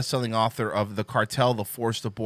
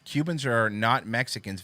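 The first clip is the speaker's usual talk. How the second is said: usual speaking voice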